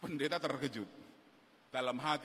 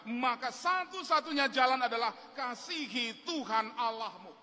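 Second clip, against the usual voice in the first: raised, insistent sermon voice; fervent preaching; 10 semitones higher than usual. Acted. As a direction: drive the promise home to the congregation. Preaching to the congregation. (khotbah yang berapi-api)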